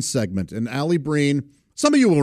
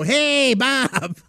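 imitating Biggerstaff; 12 semitones higher than usual. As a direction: greet him in his own voice, teasing. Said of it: "he does kinda talk like that".

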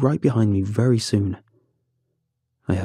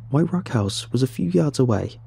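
ordinary delivery, nothing marked

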